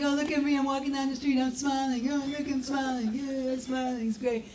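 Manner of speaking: high, sugary mock-cheerful act; fake cheer.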